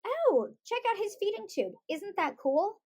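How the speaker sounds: put-on light, lifted casual remark to a child; easygoing show of interest; casual enthusiasm. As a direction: point it out casually, as something cool. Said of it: "I very casually say".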